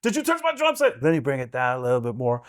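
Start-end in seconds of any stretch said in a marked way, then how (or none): 0.0-1.0 same line pitched much higher, tighter; Mark Ruffalo impression. Shown as a demonstration. Showing the hosts Mark Ruffalo, higher. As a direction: squeeze the throat and lift it up. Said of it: "It becomes Mark Ruffalo".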